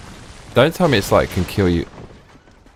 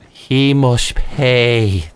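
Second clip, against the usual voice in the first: slow, drawn-out, higher movie-villain vow; dramatic vengeance vow; 5 semitones higher, much slower than usual. Pretend. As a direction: stretch it out like a grim revenge oath. Catchphrase after retelling.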